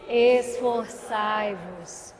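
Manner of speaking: drawn-out, deep "Esforçai-vos"; very slow solemn. Shown as a demonstration. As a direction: stretch every syllable of "Esforçai-vos" solemnly. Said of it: "Repete comigo".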